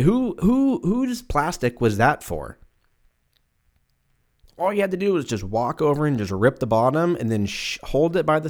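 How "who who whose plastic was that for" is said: incredulous. Real, not acted. Incredulous rant about plastic.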